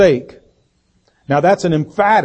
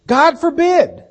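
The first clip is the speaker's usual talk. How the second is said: emphatic; 13 semitones higher, slower than usual. Shown as a demonstration. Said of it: "the emphatic double negative".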